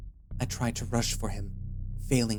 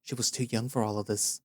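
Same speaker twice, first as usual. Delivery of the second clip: ordinary delivery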